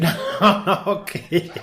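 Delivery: insisting through laughter, pitched high; slow, laughing protest. Real, not acted. Telling co-host the story is true, laughing.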